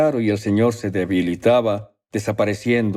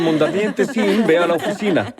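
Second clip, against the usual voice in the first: loudspeaker call; 7 semitones higher than usual. Retold or imitated. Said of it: "en el altavoz dijeron".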